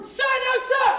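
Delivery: loud recruit reply; shouting. Acted. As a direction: yell it at attention.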